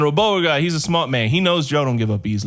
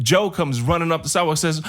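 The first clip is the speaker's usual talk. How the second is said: usual speaking voice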